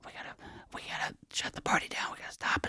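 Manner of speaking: urgent party-ending whisper, barely audible; hushed whispering. Retold or imitated. Guests warned by the front desk shushing the party, whispering. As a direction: imitate a roomful of people whispering. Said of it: "Everybody was all whispering".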